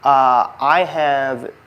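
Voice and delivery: ordinary talk, nothing marked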